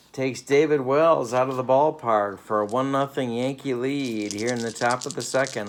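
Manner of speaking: normal talking voice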